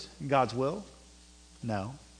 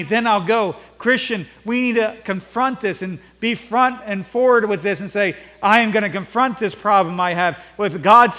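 loud, urgent pulpit exhortation; raised-voice sermon driving the point home; forceful preaching; 6 semitones higher, 6 dB louder than usual. Acted. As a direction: preach it hard, punching key words.

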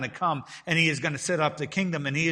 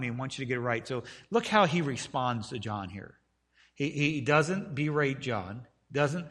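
ordinary talk, nothing marked

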